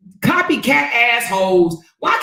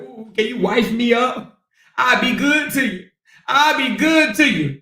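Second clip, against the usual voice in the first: mock pleading, pitched up; mock pleading suitor; 3 semitones higher than usual. Imitating a rival wooing someone's husband, mock pleading. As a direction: imitate her offering herself, a bit higher. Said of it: "She probably like".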